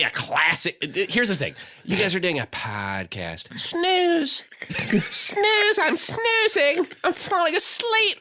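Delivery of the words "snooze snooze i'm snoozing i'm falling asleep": high, drawn-out teasing snooze; mock bored heckle. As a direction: whine it like a bored listener. Mocking co-host's podcast as boring.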